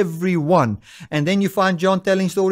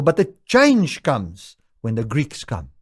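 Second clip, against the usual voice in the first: ordinary delivery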